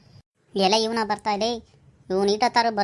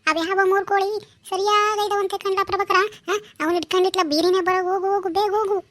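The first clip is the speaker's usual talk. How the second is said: urgent hurry; 6 semitones higher than usual. Acted. Urging friend to go quickly.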